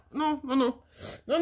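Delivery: ordinary talk, nothing marked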